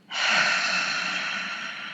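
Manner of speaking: long breathy ha exhale; ha breath sound. Shown as a demonstration. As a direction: fog the glasses with your breath. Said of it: "make the ha sound".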